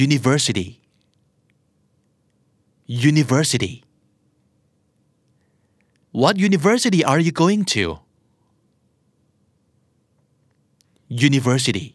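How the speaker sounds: "University" ending in "-si-dee"; American flap T. Shown as a demonstration. Teacher drilling learners on university, flap T. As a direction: soften the T in "University" to a tapped D. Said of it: "T ที่อยู่กลางคำแล้วกลายเป็นเสียงตัว D".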